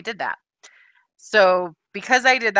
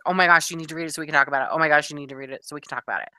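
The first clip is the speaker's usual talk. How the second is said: eager rush; much faster than usual. Retold or imitated. Son's eager plea, retold.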